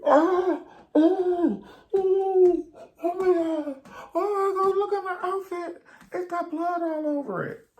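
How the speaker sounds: very high, loud, drawn-out oh my God; mock shrieking victim. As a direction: wail like a hysterical patient. Mimicking the screaming victim.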